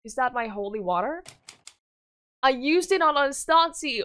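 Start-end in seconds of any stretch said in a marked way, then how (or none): 2.4-4.0 crying confession. Acted.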